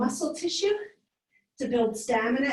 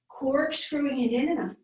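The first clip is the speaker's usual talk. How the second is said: ordinary talk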